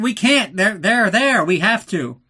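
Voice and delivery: loud, high mock protest; mock exasperated reply. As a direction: shout back at him, exasperated. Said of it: "He's like".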